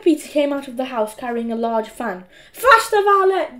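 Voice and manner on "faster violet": high, loud bossy command; imperious demand. A narrator's reading. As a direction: hurry the servant along, loudly. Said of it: "demanded Margaret".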